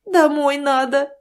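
crying. A narrator's reading. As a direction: plead to go home while weeping. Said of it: "плача отвечала Зинаида дочери".

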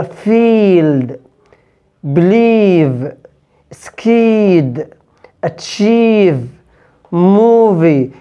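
فيلد said slowly with a long stretched "ee"; long English ee. Shown as a demonstration. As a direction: hold the long "ee" in فيلد، بليف، سكيد، أتشيف، موفي. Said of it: "بنطقها لونج إي طويلة". (إي طويلة ممدودة ببطء)

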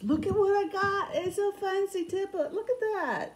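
very high-pitched hammed-up English voice; badly faked English accent, excited and way up high. Vlogger showing off her fancy find, bad English accent. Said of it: "I go into my very bad English voice".

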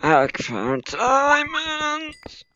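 very high, very slow drawn-out cry; thrilled squeal. Real, not acted. Miner announces his find to viewers in a very high, slow squeal. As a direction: sing it out in delight. Discovering diamonds.

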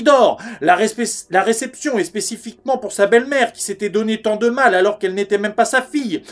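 frantic, high-pitched rush of complaint; agitated Yvan. Acted. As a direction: pour it out breathlessly, phrase upon phrase. Plays Yvan's agitated monologue on stage. (Yvan affolé, tirade précipitée)

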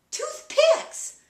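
very high, loud mock wife voice; hammed-up high-pitched correction; wife's exclamation. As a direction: squeal it out as the wife. Acts wife's triumphant mishearing.

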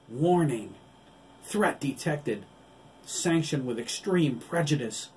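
loud, high, slow robotic alert; battle robot's urgent warning; loud machine alarm. Acted. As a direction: blare it like a combat computer. Automatic combat mode alarm.

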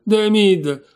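loud, high cry of the name; exasperated exclamation on Demid; exasperated outburst. Acted.

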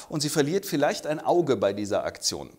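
ordinary talk, nothing marked

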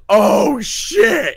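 mock shocked chorus; mock gasp. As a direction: react like the room just caught on. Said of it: "everyone just goes".

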